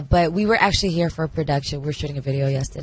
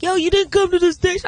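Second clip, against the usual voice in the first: high mock scolding; light retold friend voice; imitating a friend; 13 semitones higher than usual. Quoting friend's complaint.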